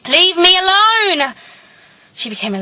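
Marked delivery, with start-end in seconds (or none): 0.1-1.3 high, loud, drawn-out shriek; piercing slow wail of rejection; anguished scream. Acted. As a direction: scream at him to go away, stretching it out. Acted outburst of the tormented girl.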